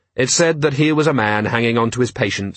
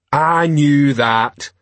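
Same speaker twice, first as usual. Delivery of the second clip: slow, drawn-out troll reply; troll covering up; 3 semitones higher, 3 dB louder, much slower than usual. Acted. Troll corrected by constable, slow ponderous comeback. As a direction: stretch each word ponderously.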